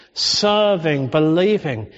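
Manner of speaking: ordinary talk, nothing marked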